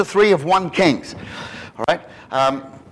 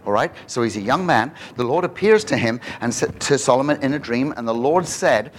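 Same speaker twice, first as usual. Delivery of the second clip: ordinary talk, nothing marked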